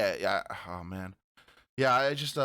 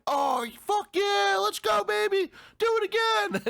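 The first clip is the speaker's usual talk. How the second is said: gleeful shout; 4 dB louder than usual. Pretend. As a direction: yell it out like a maniac loving the punches. Acting himself relishing getting punched.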